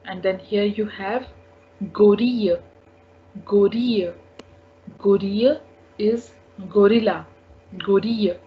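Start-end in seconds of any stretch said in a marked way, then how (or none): 1.9-4.1 "gorille" said go-ree-yuh; silent double L. Shown as a demonstration. Teacher showing French double L, "gorille" as go-ree-yuh. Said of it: "We do not pronounce this double L". 7.8-8.4 "gorille" said go-ree-yuh; silent double L. Shown as a demonstration. Teacher showing French double L, "gorille" as go-ree-yuh. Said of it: "We do not pronounce this double L".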